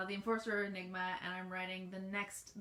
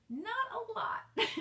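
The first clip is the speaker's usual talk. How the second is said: high, drawn-out answer breaking into laughter; amused admission; 12 semitones higher, 3 dB louder than usual. Real, not acted. Author owning up to a fan about little action, amused admission. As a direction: stretch it high, then laugh.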